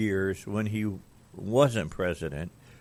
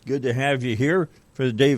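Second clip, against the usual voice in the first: upbeat show opener; 4 semitones higher, 7 dB louder than usual. Real, not acted. Host opens the radio show.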